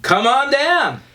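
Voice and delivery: high, slow, drawn-out announcer call; game show announcer. Pretend. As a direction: belt it like a TV game show host inviting contestants. Echoes host's catchphrase like announcer.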